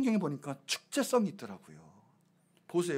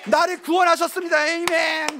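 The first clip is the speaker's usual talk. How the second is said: high, loud imitation of a testimony shout; imitated loud amen outburst; noisy congregation; 10 semitones higher, 7 dB louder than usual. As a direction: call out like an eager worshipper. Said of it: "말씀 중에 시끄러워요".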